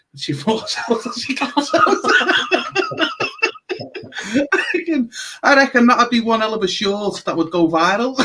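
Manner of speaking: laughing through the words; giggling at her own mischief plan; breaking up with laughter mid-sentence. Real, not acted. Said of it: "Sorry, guys".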